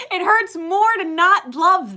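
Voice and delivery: high, loud laugh running into slow words; laugh, then say it slowly through the laughter. Real, not acted. Laughing at her own realization to the viewer.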